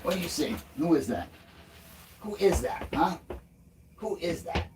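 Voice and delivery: high, soft sing-song to the dog; doggy talk. Real, not acted. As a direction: coo gently at the running dog. Coos to the dog running.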